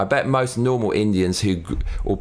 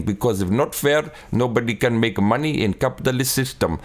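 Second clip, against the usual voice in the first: put-on Indian accent. Comedian parodying an Indian shopkeeper haggling with a customer, Indian accent. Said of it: "the Indian voice. He's like".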